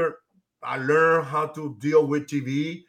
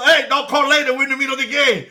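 higher and louder, retelling a yell at the caller; retold shout; 8 semitones higher, 5 dB louder, faster than usual. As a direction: bark it back like he's annoyed mid-game. Ozzie yelling at caller mid-game.

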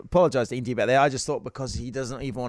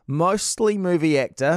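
dramatic gossip reading; 5 semitones higher, slower than usual. Acted.

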